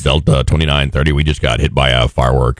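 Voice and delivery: very low, laid-back pilot radio call. Retold or imitated. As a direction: drop deep and casual like a captain. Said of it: "they drop down to that".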